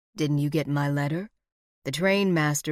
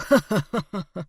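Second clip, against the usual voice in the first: mocking chuckle; 3 semitones higher, 4 dB louder than usual. A narrator's reading. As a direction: chuckle at her threat. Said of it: "He chuckled".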